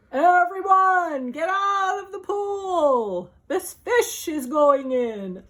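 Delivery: slow, loud commanding shout; yelled. Acted. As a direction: clear the pool. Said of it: "the fireman yelled".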